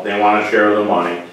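ordinary delivery

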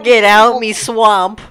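Shrek voice. Retold or imitated. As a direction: drag it out like Shrek.

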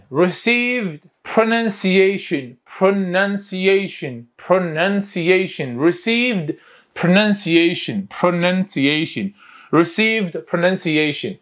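very slow, deliberate repetitions; slow pronunciation drill. Shown as a demonstration. Teacher repeats a new English term for students, slow drill. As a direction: sound the term out slowly for learners.

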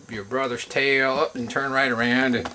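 ordinary talk, nothing marked

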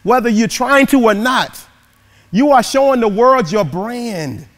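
high, loud, rousing preacher's call; preaching. Acted. Motivational speaker rousing the audience, preaching loud. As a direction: preach it to the whole crowd, big and lifted.

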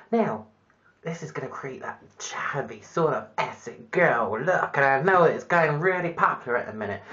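ordinary talk, nothing marked

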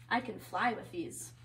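ordinary delivery

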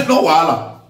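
"No wahala" with a laugh right before it; chuckle, then toss off "No wahala" mockingly; a scornful laughing "No wahala"; laughing taunt. Real, not acted.

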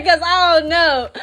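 high, breaking into a laugh; laughing disbelief. Real, not acted. Telling viewers about the book, laughing in disbelief. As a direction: laugh it out, unable to believe it.

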